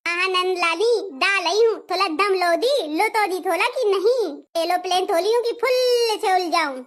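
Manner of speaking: "एरोप्लेन थोली हूँ" lisped with r turned to l and s to th; a whiny lisping plea for breath; lisping bride. Acted. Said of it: "तोतला के गाऊंगी और तोतला के बोलूंगी". (हाँफती, तोतली शिकायत)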